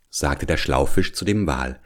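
ordinary delivery, nothing marked

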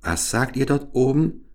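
mild storybook calling out. A narrator's reading. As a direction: call up with a question, not shouting. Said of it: "rief Gerda".

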